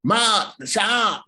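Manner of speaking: high, hammed-up Boston accent; mock Boston yapping with dropped r's. Shown as a demonstration. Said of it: "That's my Boston accent".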